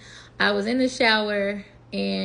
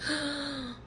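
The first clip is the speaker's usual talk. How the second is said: retold gasp; 6 semitones higher, 8 dB quieter than usual. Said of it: "I was out of breath".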